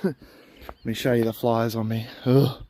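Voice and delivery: ordinary delivery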